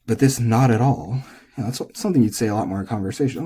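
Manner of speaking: usual speaking voice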